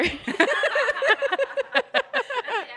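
high, long peal of laughter; laughter rising high and trailing down. Real, not acted. Laughing at her own answer.

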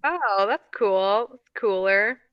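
ordinary delivery

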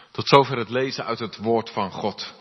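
usual speaking voice

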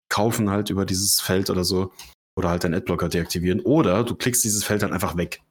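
ordinary delivery

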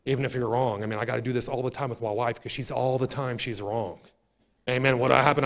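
ordinary talk

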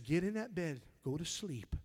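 low, soft fatherly voice of God; God's voice. Retold or imitated. Preacher voicing God telling him to go to bed, low and soft. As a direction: speak it low and gentle, like a father. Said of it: "He said".

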